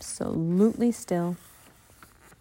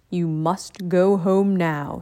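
a lower, slow, measured master's voice; calm ninja master; 2 semitones lower, slower than usual. Acted. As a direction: speak like a wise old teacher.

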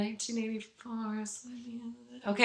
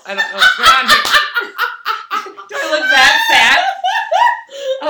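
much higher and louder, laughing through it; laughing disbelief; 13 dB louder than usual. Real, not acted. Asking her friend why she was picked, laughing loudly. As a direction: shriek the question through giggles.

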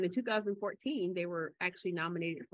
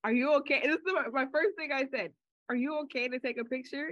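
high, louder surprised question; pitched-up check-in; 6 semitones higher, 3 dB louder than usual. Retold or imitated. Retelling own surprised reply.

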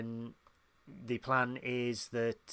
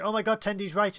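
high, loud burst of realisation; excited realisation; 8 semitones higher, 5 dB louder than usual. Retold or imitated. Podcaster voicing a character motivated by advice, excited realisation. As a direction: exclaim it, imitating an excited character.

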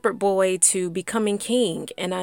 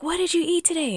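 higher, eerie question right in the ear; eerie whisper in the ear; 11 semitones higher, faster than usual. Retold or imitated. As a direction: mom appears in her ear, eerie. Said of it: "she scared me so bad".

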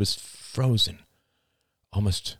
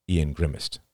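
ordinary delivery, nothing marked

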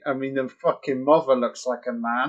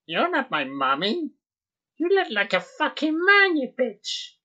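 put-on child's voice; 11 semitones higher than usual. Voicing the child on screen.

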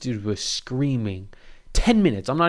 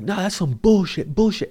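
angry; 8 semitones higher than usual. Retold or imitated. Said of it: "He's like".

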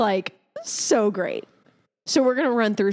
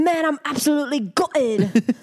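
hammed-up British bloke, a bit higher; mock British accent; 4 semitones higher than usual. Trying out British slang on a friend, mock British accent. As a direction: put on a cheeky British voice. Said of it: "I like this one".

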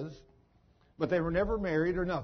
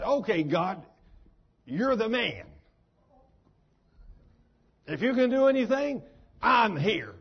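raised, emphatic retold plea; bold surrender; 3 semitones higher, 5 dB louder than usual. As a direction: call out to God, building louder. Sinner gives in, challenges God.

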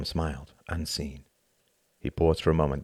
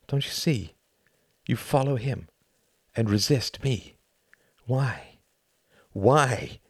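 frustrated plea; 5 semitones higher, slower than usual. Acted. Tom pleads with Hart.

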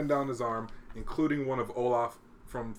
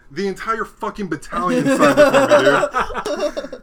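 laughing punchline; 11 semitones higher, 12 dB louder, slower than usual. Real, not acted. Punchline of army story.

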